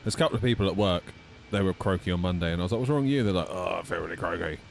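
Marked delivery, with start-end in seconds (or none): none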